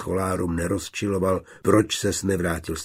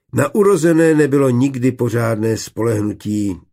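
ordinary delivery, nothing marked

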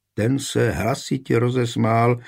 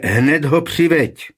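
louder, higher, slow furious command; angry roar; 6 semitones higher, 5 dB louder, slower than usual. A narrator's reading. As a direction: bellow the order, slowly and furiously. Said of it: "zařval Vaněk Valečovský tak zlostně". (zlostně zařvat, pomalu a hlasitě)

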